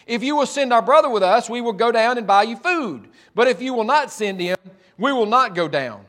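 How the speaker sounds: ordinary talk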